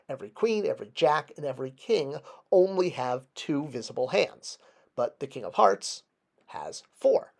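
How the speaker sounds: normal talking voice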